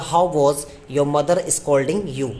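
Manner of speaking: slow, word-by-word dictation. Shown as a demonstration. Teacher dictating the sentence to students, slowly. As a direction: spell it out word by word for the class. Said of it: "dictating the sentence".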